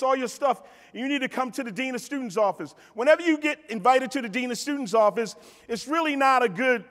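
ordinary talk

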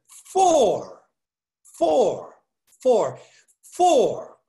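"for" said over and over, loud; a loud, drilled "for, for, for"; repeated stress. Shown as a demonstration. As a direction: hammer "for" again and again. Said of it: "Underline the word "for".

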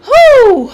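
high, loud startled whoop; a sudden yelp of surprise. Real, not acted. Startled gamer cries out.

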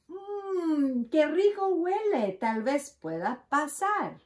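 a badger sniffing out dinner; hungry badger. A narrator's reading.

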